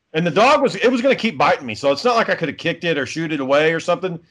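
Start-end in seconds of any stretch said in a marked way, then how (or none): none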